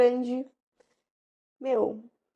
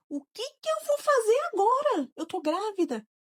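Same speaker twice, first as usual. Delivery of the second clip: retold distress; 9 semitones higher, 3 dB louder than usual. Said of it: "Aquela pergunta lá de quando tudo aconteceu".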